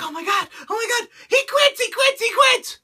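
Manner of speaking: high, fast panicked squeal; mock panicked Lana. Lana panicking ringside, mocked in a high squeal. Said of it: "She's like".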